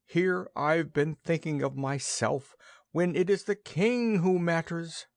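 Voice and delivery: high, regretful goose; remorseful. A narrator's reading. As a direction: sound sorry for yourself. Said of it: "groaned the goose remorsefully".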